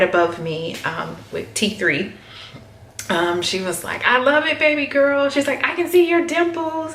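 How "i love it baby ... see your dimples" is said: imitating her sister. Sister voiced in retold story.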